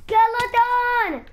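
high, loud drawn-out yell; alarmed scream. Real, not acted. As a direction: shriek the name in alarm. Spotting a skeleton.

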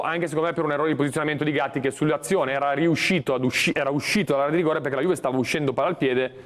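normal talking voice

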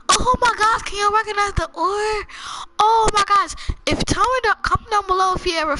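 high, loud burst of amazement; excited discovery. Real, not acted. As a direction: exclaim at a thrilling find, pitch way up. Excited find of an ore.

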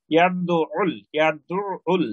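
ইয়াদউল with a clear, doubled আইন; careful pronunciation. Shown as a demonstration. Teacher models ইয়াদউল for students. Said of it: "ইয়াদউল বললে".

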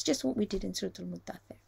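usual speaking voice

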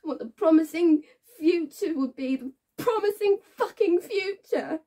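high, loud, anguished sarcasm; bitter outburst. Acted. Woman recalling forced letters about hope, bitterly worked up. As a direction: push out the words with rising bitterness, stretching some.

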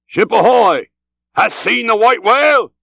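high, loud call across to another ship; long-carrying ship's hail, pitched high; Ahab hailing. A narrator's reading. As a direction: cry it out as a sea captain hailing a vessel. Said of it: "So cried Ahab, hailing a ship".

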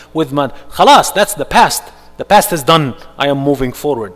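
loud, rising, resolute rebuke; defiant declaration. Retold or imitated. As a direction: declare it like a victory. Believer rebuking Shaitan, moving on.

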